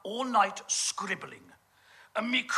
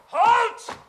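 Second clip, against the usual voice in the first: high, loud barked halt; sudden guard's shout; shouted command; 13 semitones higher, 8 dB louder than usual. Acted. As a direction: bark the order to stop.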